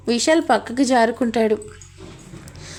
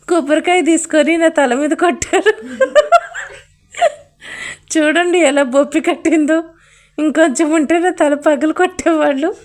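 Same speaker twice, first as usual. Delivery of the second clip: complaining priest; 3 semitones higher than usual. Acted. Priest complains about coconut blow.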